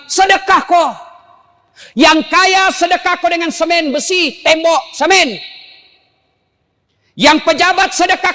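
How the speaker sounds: preacher urging the congregation to give; fiery preaching. Acted. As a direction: preach it hard to the crowd. Preaching at full force.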